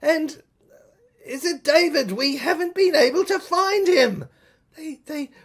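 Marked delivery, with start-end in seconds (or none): none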